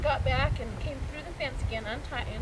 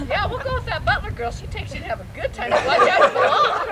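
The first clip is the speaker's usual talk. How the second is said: laughing mock warning, louder at the end; laughing teasing; 6 semitones higher, 10 dB louder than usual. Real, not acted. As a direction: tease her while still giggling.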